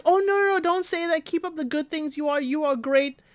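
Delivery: high-pitched gushing imitation of her; mock her sweet reassurance way up high; imitating Katisha. Katisha reassuring Damola, high mock gushing.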